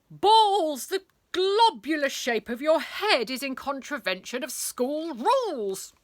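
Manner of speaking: pompous furious teacher; stern teacher. Acted. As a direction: bellow it like a tyrant headmaster. Angry teacher Pent scolding boy.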